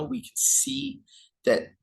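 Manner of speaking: hiccuping mid-sentence; speaking through hiccups. Real, not acted. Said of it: "hiccuping and burping".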